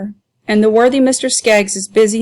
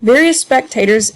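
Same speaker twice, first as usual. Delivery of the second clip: ordinary delivery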